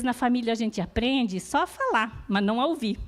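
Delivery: ordinary delivery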